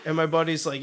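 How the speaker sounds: ordinary talk, nothing marked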